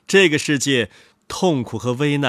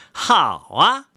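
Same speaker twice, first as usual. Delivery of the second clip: higher, chuckling crook; amused thief; 6 semitones higher than usual. A narrator's reading. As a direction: laugh him off, then dare him. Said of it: "小偷说。".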